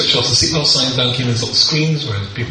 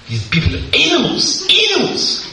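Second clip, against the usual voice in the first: mock outrage. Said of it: "he said".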